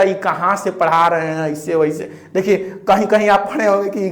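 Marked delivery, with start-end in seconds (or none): 0.0-2.1 lower, softer skeptical student voice; mock the student's doubt quietly; imitating a doubting student. Teacher voicing a skeptical student's objection, lower and softer. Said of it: "अब कहिएगा".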